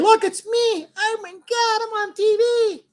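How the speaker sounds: very high-pitched, giddy put-on voice; mock starstruck squeal. Host checking his stream sees himself on TV, mock starstruck squeal. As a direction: squeal it like an overexcited fan.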